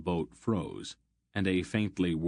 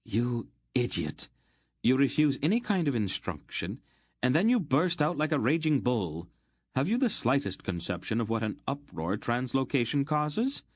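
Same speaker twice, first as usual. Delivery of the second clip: higher, scolding fury; furious scolding; 6 semitones higher than usual. A narrator's reading. Aunt Paul scolding Geryon for his rash magic, furious. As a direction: tear into him for his blunder. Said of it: "her eyes blazing".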